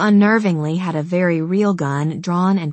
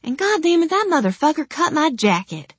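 much higher, outraged rant; indignant outburst; 10 semitones higher than usual. Acted. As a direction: vent the outrage, high pitched. Outraged over ruined jacket.

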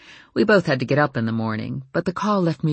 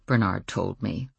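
ordinary delivery

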